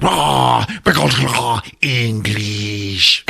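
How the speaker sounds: guttural mock alien babble; Drac alien voice. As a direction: imitate an alien growling back. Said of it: "And the Drac's like".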